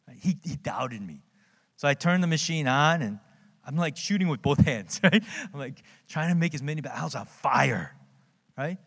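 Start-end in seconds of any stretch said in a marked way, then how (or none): none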